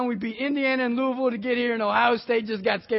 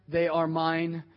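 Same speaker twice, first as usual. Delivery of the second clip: usual speaking voice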